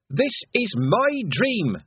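high, slow, defiant cry to the sky; shouted at the sky. Acted. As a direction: claim the dream as yours, slowly. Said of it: "she shouted at the sky".

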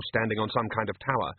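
ordinary delivery

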